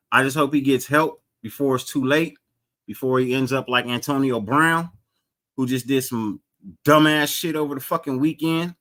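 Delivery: ordinary talk, nothing marked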